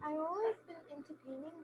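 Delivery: very soft, far from the mic; speaking too quietly; barely audible. Real, not acted. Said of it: "your voice is very low".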